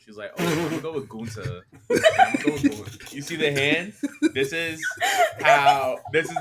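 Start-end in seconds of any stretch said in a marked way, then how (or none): 0.4-3.2 mocking a friend's New York accent, laughing. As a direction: ham up a New York girl announcing Gunther. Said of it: "she really sounded like a New York bird".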